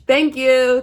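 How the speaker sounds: milk the fake applause like a comic; mock comedian bow. To viewers after her joke, mock comedian thanks. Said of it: "I'm here all night".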